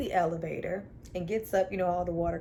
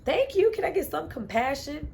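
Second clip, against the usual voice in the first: higher, sarcastic demand for sympathy; sarcastic plea; 6 semitones higher than usual. Retold or imitated. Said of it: "I was like".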